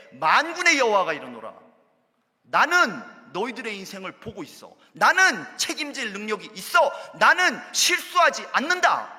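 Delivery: booming promise, rising; God's thundering voice. Acted. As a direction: proclaim it as God himself. Voicing God's assurance.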